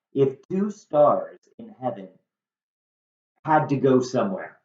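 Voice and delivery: usual speaking voice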